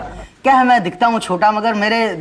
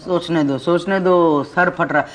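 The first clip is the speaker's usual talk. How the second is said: ordinary delivery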